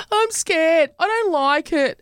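high-pitched imitation of worried listeners; mock scared. Voices worried listeners' feedback.

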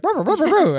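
high stuttered ruh-ruh noise; sputtering engine. Pretend. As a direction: sputter it like a stalling motor. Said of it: "And it's like".

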